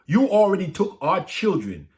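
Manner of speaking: high, loud accusing shout; angry crowd. Acted. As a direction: hurl it back at the king. Angry townspeople confront the king.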